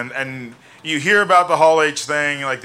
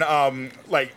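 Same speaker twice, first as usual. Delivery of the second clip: ordinary talk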